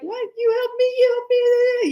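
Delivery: put-on high childlike whine; like a little kid. A player mocking his agent like a little kid, in a high childlike voice. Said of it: "like a little kid".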